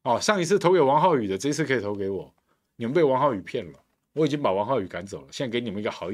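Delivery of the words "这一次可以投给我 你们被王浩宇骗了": usual speaking voice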